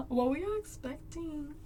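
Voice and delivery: ordinary delivery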